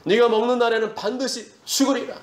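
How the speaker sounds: "네가 먹는 날에는" high from the first word; high, insistent divine warning, "반드시" held up. Acted. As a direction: declare it in a high preaching pitch through "죽으리라". Repeats God's warning, raised.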